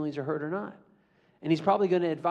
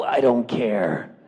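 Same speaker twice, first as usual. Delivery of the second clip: low, slow uncaring drawl; bored bully; 10 semitones lower, 6 dB louder, much slower than usual. Acted. Bully answers the hurt kid, low and slow. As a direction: show you couldn't care less.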